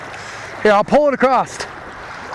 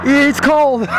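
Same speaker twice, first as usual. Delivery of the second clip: ordinary delivery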